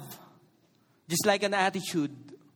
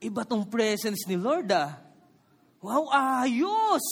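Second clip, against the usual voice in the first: hammed-up thrill, higher and brighter in tone; mock excited; 4 semitones higher, much slower than usual. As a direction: play it like a kid impressed by something cool. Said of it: "Ba't kaya hindi niya sinabi".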